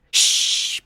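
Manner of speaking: unvoiced shush; hushing. A narrator's reading. Narrator hushes friends in the cafeteria, whispered shush. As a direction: hush them like a librarian. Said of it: "I said".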